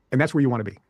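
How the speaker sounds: usual speaking voice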